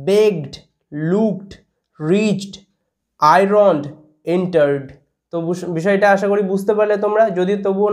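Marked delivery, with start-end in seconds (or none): 0.1-4.8 বেগড, লুকড, রিচড each apart, closing on a half d or t; word-list pronunciation demo. Shown as a demonstration. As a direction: model আয়রনড, এন্টার্ড and the rest with no vowel after the last stop. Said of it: "শব্দগুলোকে আমি আরেকবার repeat করে দিচ্ছি". (বেগড থেকে এন্টার্ড, শেষে অর্ধেক ড উচ্চারণ)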